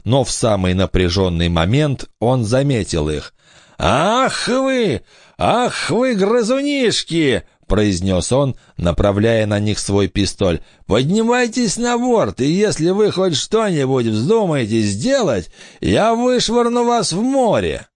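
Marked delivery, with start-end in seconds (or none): 3.8-7.4 high-pitched, drawn-out pirate menace; threatening pirate. A narrator's reading. Pirate catches children on deck, high menacing voice. 10.9-17.9 high, forceful pirate threat; threatening pirate. A narrator's reading. Pirate orders stowaway children aboard, high threatening voice.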